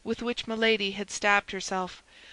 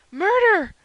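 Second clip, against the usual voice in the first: very high, loud scream; screamed alarm; 12 semitones higher, 11 dB louder than usual. A narrator's reading. Patrick seeing his master stabbed, screaming the alarm. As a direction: scream to raise the household. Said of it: "screamed Patrick".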